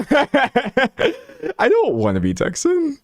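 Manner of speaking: high, drawn-out laughing wail. Real, not acted. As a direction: laugh helplessly through the words. Cracks up repeating own confession.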